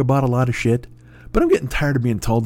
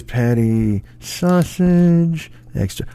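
slow repeat; 4 semitones higher, much slower than usual. Retold or imitated. Employee slowly repeating the order.

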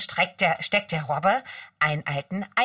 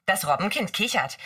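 ordinary delivery, nothing marked

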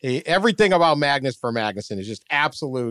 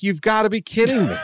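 mock disbelief; 7 semitones higher than usual. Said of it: "I was like".

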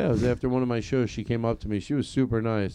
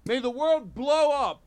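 melodramatic oath; 11 semitones higher than usual. Pretend. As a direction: swear it like a grand tragic oath. Melodramatic oath swearing innocence.

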